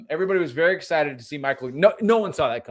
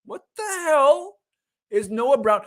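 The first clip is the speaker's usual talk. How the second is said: high, slow, stunned disbelief at the lineup; incredulous squeal dragged out slowly; retold disbelief; 11 semitones higher, 4 dB louder, slower than usual. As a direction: replay the moment you saw the wrong receiver, aghast. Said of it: "And then I was like".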